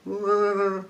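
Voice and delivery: high mock Wookiee wail; a quick sad Wookiee cry. Chewie answering Luke about Han, a high mock wail. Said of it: "Chewie was just like".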